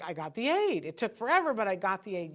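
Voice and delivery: happy parent. Retold or imitated. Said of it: "They're like".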